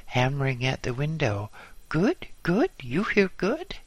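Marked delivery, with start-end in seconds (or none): 1.9-3.8 high, eager questioning; excited foreigner checking he is heard; Marcel. A narrator's reading. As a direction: pitch it up, each question leaping higher. Marcel excitedly hammering at the window.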